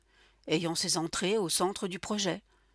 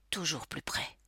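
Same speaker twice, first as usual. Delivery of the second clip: "toujours plus près" breathed as an unvoiced whisper; 6 dB quieter than usual. A narrator's reading. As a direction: whisper it as the danger closes in.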